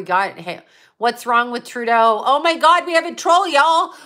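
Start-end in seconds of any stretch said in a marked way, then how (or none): 2.2-3.9 higher, louder gleeful alarm; excited outrage at a troll; mock shock. Real, not acted. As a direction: announce the troll with delight. Spotting a troll in chat.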